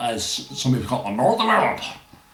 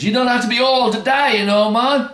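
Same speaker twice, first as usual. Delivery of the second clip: put-on Jamaican accent, higher and louder; Jamaican matter-of-fact; 13 semitones higher, 5 dB louder than usual. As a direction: shrug it off in Jamaican. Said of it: "The guy goes".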